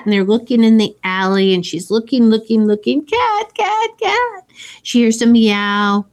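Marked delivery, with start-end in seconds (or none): 3.0-4.4 high mock call for a lost cat, much higher. Holly calling for the cat.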